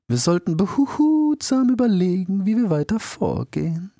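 put-on solemn, careful old owl; wise old owl. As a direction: weigh each word like a cautious sage. Old owl Midas voiced.

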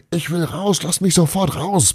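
high-pitched yell, held back in volume; frantic high demand to be let go. A narrator's reading. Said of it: "brüllte Bruno".

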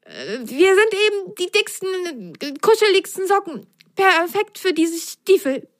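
high shivering sock voice. Acted. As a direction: shiver while trying to sound proud. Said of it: "bibberte Patsch". (bibbernde hohe Stimme)

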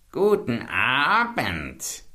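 langsam, hoch und krächzend geschnarrt; schnarrende Hexe. A narrator's reading. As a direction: wie eine alte Hexe heuchlerisch grüßen. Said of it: "schnarrte sie". (langsam geschnarrter Hexengruß)

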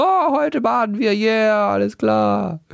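high, slow chirpy midwife; hammed-up cheerful bath invitation; lightly voiced cheery midwife; mock midwife voice.

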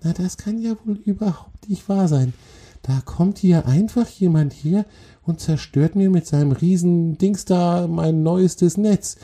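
tiny, very high-pitched scolding creature; fine, angry voice. A narrator's reading. Spider scolds the troll for wrecking her newest web, fine angry voice. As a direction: scold in a fine high squeak, thoroughly annoyed. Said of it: "eine ganz feine, aber äußerst ärgerliche Stimme".

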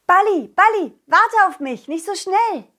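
high, urgent call to a friend ahead. Acted. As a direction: call after someone who is getting away. Calling after Balli running ahead.

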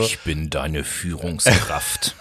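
deep, slow villain voice; slow, menacing Darth Vader rumble. Pretend. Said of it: "so wie Darth Vader".

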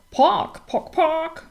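high clucking hen call; cackling hen. A narrator's reading. Little hen cackling at the falling nut, high. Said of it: "krakelte das kleine Huhn".

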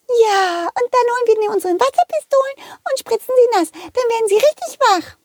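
put-on very high, fast kid voice; a cheeky child bursting with a plan; squeaky rushed little kid; excited child. Child plans to spray teacher.

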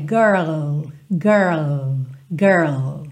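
put-on "girl" low, curled R then tongue up for the L; full girl with L. Shown as a demonstration. Teacher drilling the full word "girl" in low repetitions. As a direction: slide from curled R into a clear L in "girl". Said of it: "põe a língua no céu da boca pro L".

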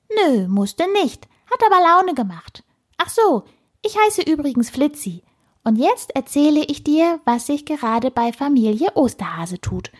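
high, piping and impish; cheeky squirrel voice. A narrator's reading. Squirrel introduces itself to the startled girl, cheeky. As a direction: answer back in a squeaky little voice.

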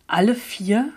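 a wide-eyed surprised question; astonished. A narrator's reading. Narrator asking Maliksi about elementals, astonished. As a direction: ask it amazed, lifting at the end. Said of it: "fragte ich erstaunt".

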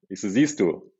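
ordinary talk, nothing marked

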